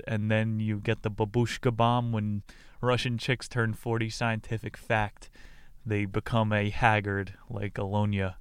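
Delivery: usual speaking voice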